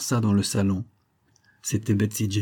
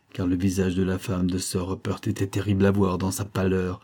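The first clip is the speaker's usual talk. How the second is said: usual speaking voice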